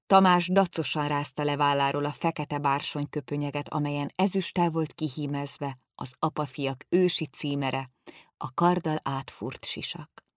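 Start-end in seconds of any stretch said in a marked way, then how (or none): none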